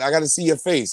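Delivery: ordinary talk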